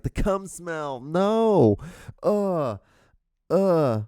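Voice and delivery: high, drawn-out horrified "no", then grunts; grossed-out wail with disgusted groans; acted disgust. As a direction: recoil in mock horror, pitch climbing. Acts out disgusted reaction to smell.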